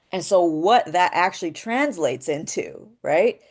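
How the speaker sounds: ordinary delivery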